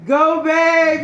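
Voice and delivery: high, loud cheer. Retold or imitated. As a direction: shout it like from the crowd. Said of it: "It was my husband".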